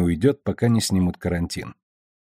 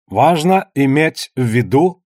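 put-on slow, measured old official, pitched up; slow, avuncular TV statement, slightly raised voice; kindly old uncle; 4 semitones higher, 4 dB louder, slower than usual. A narrator's reading. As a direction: address the camera like a kindly uncle, weighing each word. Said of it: "говорил старик, глядя в камеру глазами доброго дядюшки".

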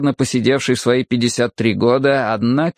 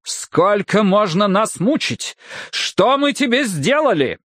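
anguished outcry; 9 semitones higher, slower than usual. Acted. Desperate father cries out.

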